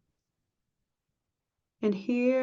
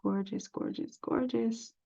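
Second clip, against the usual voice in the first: murmured admiration; 10 dB quieter than usual. Real, not acted. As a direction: murmur it admiringly under your breath. Admiring patterns while browsing to herself.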